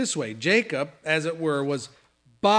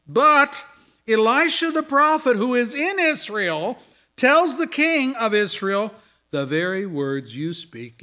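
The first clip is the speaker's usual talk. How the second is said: reads the scripture loud and high, voicing the angry king; full-force preaching; 7 semitones higher, 7 dB louder than usual. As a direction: proclaim it from the pulpit at full force.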